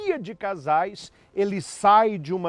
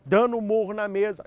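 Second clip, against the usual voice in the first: usual speaking voice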